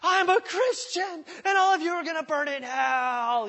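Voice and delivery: mock a pushy coworker blaring doom at the office; obnoxious preachy shout. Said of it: "totally stupid and obnoxious about it".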